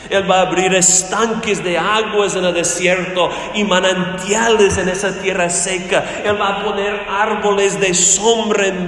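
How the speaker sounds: impassioned preacher building on each promise; full-force preaching. Acted. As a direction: proclaim it to the congregation, hitting key words hard.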